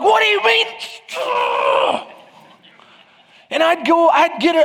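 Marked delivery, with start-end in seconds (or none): 0.0-2.0 high, stretched, loud indignant yell; husband yelling at his wife in an argument; mock argument shout. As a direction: blow up like in a fight. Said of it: "when I argue, I get the same way".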